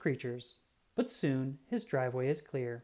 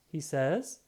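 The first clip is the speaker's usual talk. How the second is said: ordinary delivery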